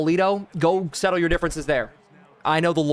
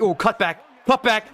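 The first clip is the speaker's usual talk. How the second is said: excited; 5 semitones higher than usual. Real, not acted. Excited football commentary.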